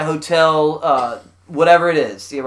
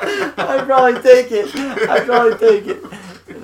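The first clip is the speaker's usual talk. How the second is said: high, slow words through laughter; cracking up, drag it out high; laughing admission; 7 semitones higher, slower than usual. Real, not acted. Podcaster answering friend's hundred dollar offer, laughing.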